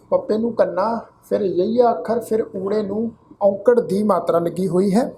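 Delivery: normal talking voice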